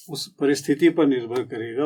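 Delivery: usual speaking voice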